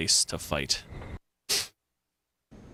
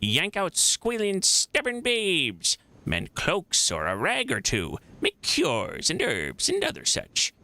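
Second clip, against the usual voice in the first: put-on rustic old midwife, swooping high on key words; high lilting, folksy old-woman voice; old crone; 5 semitones higher than usual. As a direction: list your trade like a village crone. Midwife lists her trade.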